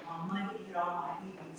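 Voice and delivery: low, soft and fast mock man's voice; mumbled brag. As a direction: rattle it off low like a proud suitor. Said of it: "he was like".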